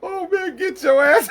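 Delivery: high, loud angry quote through laughter; laughing quote. Retold or imitated. Quoting Tony's yell, laughing. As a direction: yell it while cracking up. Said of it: "He said".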